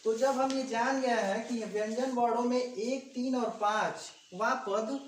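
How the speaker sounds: ordinary delivery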